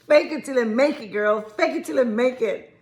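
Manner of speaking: usual speaking voice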